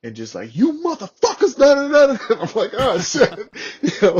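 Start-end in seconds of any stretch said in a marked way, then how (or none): 0.6-2.4 loud, high-pitched mock bellow; booming voice. Sergeant major cussing out two Marines in his office, imitated bellow. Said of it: "this booming fucking voice". 2.8-3.4 terrified. Retold or imitated. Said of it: "I'm fucking terrified of this guy".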